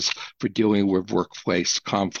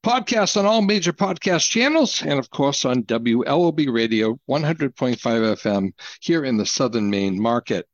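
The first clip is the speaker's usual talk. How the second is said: bright, upbeat station read, pitched up at the start; radio announcer read; 4 semitones higher than usual. Acted. Host welcoming listeners back after the ad, announcer voice. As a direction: announce the show back from the break like a DJ.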